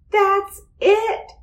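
high thrilled cry; excited scream. A narrator's reading. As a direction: scream out the discovery. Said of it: "screamed Arthur".

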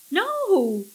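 high, loud cry of refusal; screaming. A narrator's reading. Millie refuses the alien's order, screaming. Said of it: "Millie screamed".

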